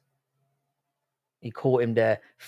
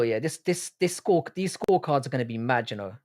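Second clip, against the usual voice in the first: usual speaking voice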